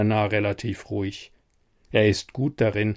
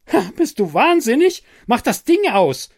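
much higher and louder, yelling in alarm; furious shout; 12 semitones higher, 5 dB louder than usual. A narrator's reading. Seeing reactors still running, yelling at Bernard. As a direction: scream at him to turn it off.

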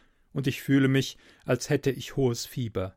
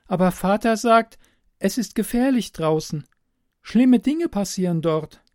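put-on higher, louder young girl voice, insistent; a frightened girl repeating what she's been told; girl's warning; 9 semitones higher, 7 dB louder than usual. Hidden girl repeats her father's warning, higher and louder.

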